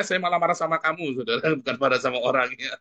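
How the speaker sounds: normal talking voice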